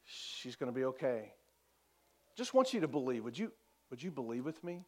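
very soft, low, unhurried; calm reassurance. Acted. Jesus calming a father whose daughter died, quiet and unruffled. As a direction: soothe gently, with long pauses. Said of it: "This is Jesus' response".

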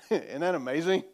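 ordinary delivery

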